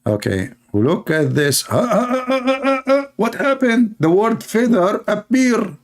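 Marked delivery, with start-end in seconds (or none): 1.7-3.1 string of high, repeated "ah, ah" gasps; hammed-up high staccato cries of amazement; mock astonished "ah"s. Mocking a translator as the missing word turns up, high astonished ahs. 3.2-5.8 high-pitched, hammed-up astonishment, stretching out the end; mock amazement. Mocking a translator over the word feather, high mock amazement.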